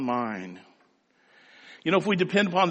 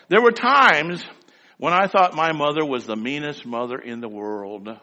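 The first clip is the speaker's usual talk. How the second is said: ordinary talk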